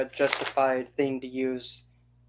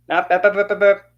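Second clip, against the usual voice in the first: higher, louder, brushing them off; loud dismissive cut-off; 6 semitones higher, 7 dB louder than usual. Real, not acted. To the extremists, a loud mocking cut-off. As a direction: bup bup bup, shut them down. Cutting off the imagined speaker.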